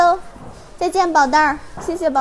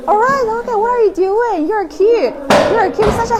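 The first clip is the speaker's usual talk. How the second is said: high-pitched sweet talk to a horse, a bit louder; 10 semitones higher, 4 dB louder than usual. Real, not acted. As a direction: coo at the horse like at a pet. Cooing at the horse.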